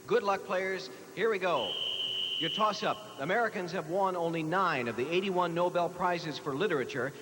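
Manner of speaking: ordinary talk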